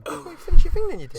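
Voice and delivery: high, loud jokey yell at a mate; mock angry shout. Real, not acted. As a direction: bark it at your friend, half laughing. Yelling at friend.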